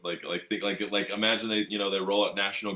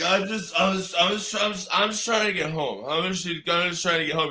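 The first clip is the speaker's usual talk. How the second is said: higher, pleading mock voice; the stopped man pleading with the guard; mock pleading guy; 9 semitones higher than usual. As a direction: whine that you just want home.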